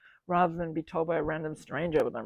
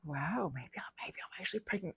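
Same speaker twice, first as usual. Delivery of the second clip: breathless whispered realization; soft unvoiced wonder; hushed whisper; 12 dB quieter than usual. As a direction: whisper it in awe.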